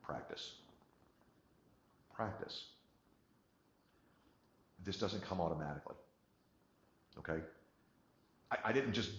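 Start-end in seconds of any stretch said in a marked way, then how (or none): 0.0-2.6 soft breathy whisper of one word; whispered practice. Shown as a demonstration. Coach whispering the word practice to the class. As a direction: hush it like a mantra.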